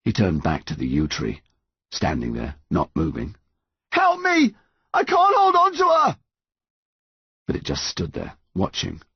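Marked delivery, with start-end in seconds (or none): 3.9-6.2 high-pitched cry for help; desperate yell. A narrator's reading. Son turns back to the yew tree, yelling for help. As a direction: yell out in panic.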